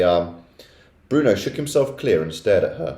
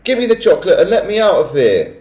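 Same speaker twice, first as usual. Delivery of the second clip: loud, higher demand; suspicious shout; 9 semitones higher, 6 dB louder than usual. A narrator's reading. Boy growing suspicious of the witches, shouting. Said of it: "shouted Bruno".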